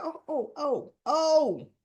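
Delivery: retold scandalized gasp of disbelief; shocked reaction. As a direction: build to a big, high final oh.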